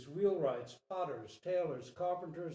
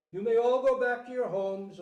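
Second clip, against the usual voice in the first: king calling out; 7 semitones higher, 11 dB louder than usual. Acted. King dismisses his people from wall.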